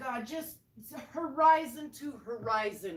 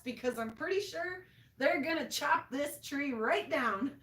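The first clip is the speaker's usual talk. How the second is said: ordinary talk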